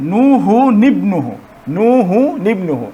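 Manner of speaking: নুহু নিবনুহু joined slowly, the নুন carried with a kasra into নিবনুহু; recitation demo. Shown as a demonstration. Teacher modeling Quran recitation slowly for students. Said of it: "এই নুনটির নিচে জের দেওয়া হয়".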